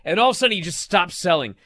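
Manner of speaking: usual speaking voice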